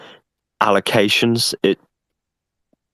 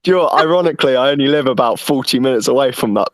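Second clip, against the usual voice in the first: higher, talking through laughter; amused, laughing at the irony; 6 semitones higher than usual. Real, not acted.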